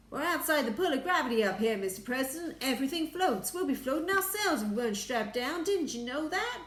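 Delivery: high, loud astronaut voice; eager explaining. A narrator's reading. Astronaut explaining floating to the President, eager. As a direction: explain it high and brightly insistent.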